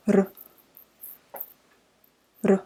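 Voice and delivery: ر with a single tongue flick; rolled r exercise. Shown as a demonstration. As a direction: trill the ر briefly. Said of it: "فصوت الحرف ر".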